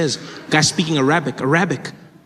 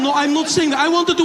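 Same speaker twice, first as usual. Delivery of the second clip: put-on high accented pleading protest; placating bomber; 10 semitones higher than usual. Would-be bomber appeasing offended passenger, placating accented voice. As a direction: backpedal in a put-on Middle Eastern accent.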